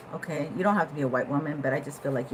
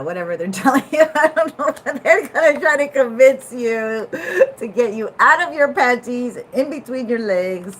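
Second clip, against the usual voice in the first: loud laughing rant; laughing loudly; 7 semitones higher, 10 dB louder than usual. Real, not acted. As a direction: laugh through the words, loud.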